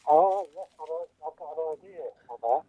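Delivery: ordinary delivery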